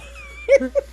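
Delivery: high-pitched burst of laughter; helpless high cackle; real laugh. Real, not acted. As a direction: crack up at your own story. Cracking up at her own story.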